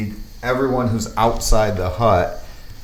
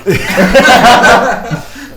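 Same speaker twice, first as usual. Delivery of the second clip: loud laugh; 13 semitones higher, 9 dB louder than usual. Real, not acted. Laughing at the gag.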